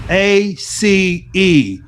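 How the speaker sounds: A C E spelled letter by letter, slowly; slow deliberate A C E. Shown as a demonstration. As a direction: spell A C E out for the class. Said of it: "toss another word out there at you called ace".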